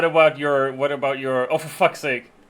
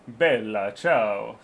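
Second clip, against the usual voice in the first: put-on Italian accent; 7 semitones lower, much slower than usual. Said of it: "There will be an Italian accent".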